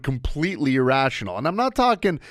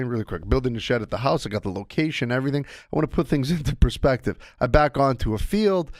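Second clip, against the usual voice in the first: ordinary talk, nothing marked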